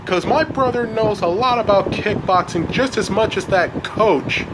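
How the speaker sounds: put-on worked-up teenage anger; mad. A narrator's reading. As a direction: defend your brother heatedly. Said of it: "Elliot gets mad".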